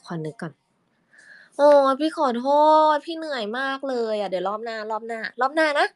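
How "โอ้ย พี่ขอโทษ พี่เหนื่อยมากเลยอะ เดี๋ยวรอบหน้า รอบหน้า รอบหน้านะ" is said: [name]'s reply. Shown as a demonstration. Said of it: "พี่ภูมิตอบกลับด้วยเสียงไหนคะ".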